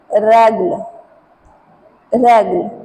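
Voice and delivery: ragl said with an open a; French pronunciation demo. Shown as a demonstration. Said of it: "with accent".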